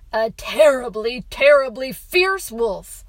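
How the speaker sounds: TERRIBLY punched loud, both times; dramatic emphasis. A narrator's reading. Father gives son his fierce wolf, loud emphasis on TERRIBLY. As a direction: lean hard on "terribly" and "fierce".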